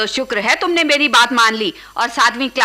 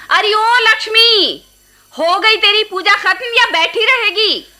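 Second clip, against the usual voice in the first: aunt hollering across the house at Lakshmi; calling out; 8 semitones higher, 3 dB louder than usual. Acted. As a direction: summon Lakshmi from her prayers, impatient. Aunt calling niece away from prayers.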